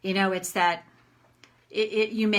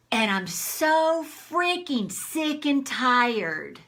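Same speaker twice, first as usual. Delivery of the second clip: loud, raised-pitch rant; demonstrated anger; 4 semitones higher, 5 dB louder than usual. Shown as a demonstration. Tapping teacher models a rant for listeners, angry. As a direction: vent out loud, drawing out the key words. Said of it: "I'm just gonna do anger".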